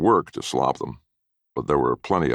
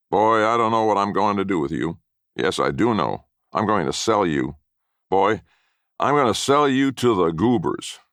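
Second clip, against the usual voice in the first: put-on higher, louder, a mean old man; nasty threat; 6 semitones higher, 4 dB louder than usual. A narrator's reading. Grandfather threatening to sell the boy, mean and loud. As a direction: gloat as you threaten to sell him. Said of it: "he began with other threats".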